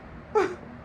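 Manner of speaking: high, squeaky giggle; squealing laugh. Real, not acted.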